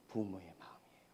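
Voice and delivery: hushed, breathy whisper; 부모의 마음이에요 in a soft whisper; whispered answer. Acted. Answering own question hushed.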